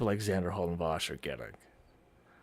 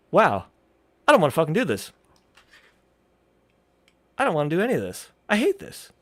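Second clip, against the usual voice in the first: retold exasperation; 7 semitones higher, 6 dB louder, faster than usual. Retelling being fed up with scripting.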